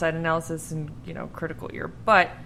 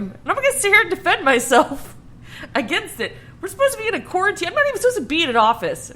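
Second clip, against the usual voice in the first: higher, rushed protest; defensive; 8 semitones higher, faster than usual. Real, not acted. Insisting she works hard to her teasing co-host, rushed and defensive. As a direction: argue your case fast and worked up. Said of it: "So defensive".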